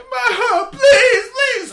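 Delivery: very high, loud crying plea; wailing child. Retold or imitated. Boy throwing a tantrum at his mom in the supermarket, very high wailing. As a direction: wail it like a tantrum.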